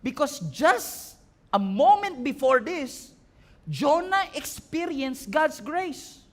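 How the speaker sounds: impassioned sermon delivery; preaching. Acted. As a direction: preach it out with fire. Preaching at full force.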